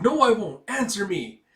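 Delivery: loud, high furious refusal; girl shouting down a warrior; defiant shout. Acted. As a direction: yell it back in defiance. Girl defying the commander.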